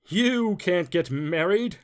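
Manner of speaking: slow, loud, menacing bug voice; scary voice. A narrator's reading. Stink bug forbidding the bunnies to marry, slow and menacing. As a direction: lay down the law slowly like a villain. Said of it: "said a scary voice".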